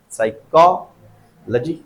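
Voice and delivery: "Psychology" very slowly as sai-KO, pause, luh-jee; broken-down stress demo. Shown as a demonstration. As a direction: break "psychology" apart, holding को. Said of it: "को आ रहा है". ("Psychology" धीरे, को पर ज़ोर)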